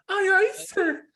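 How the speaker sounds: very high, shrill-pitched scream of a recruit; mock high scream. Mocking a recruit's high scream to the drill instructor. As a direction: squeal it like a panicked recruit. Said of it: "he screams like a girl".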